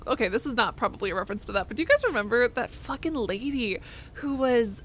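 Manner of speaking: ordinary delivery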